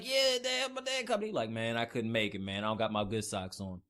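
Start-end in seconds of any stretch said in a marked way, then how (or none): 0.0-1.3 put-on high, eager child's voice; hopeful kid. Kid waiting by the door with bags, excited. 1.5-3.8 low, offhand dad voice; excuse-making dad. Acted. Dad excusing himself to his waiting kid, dismissive.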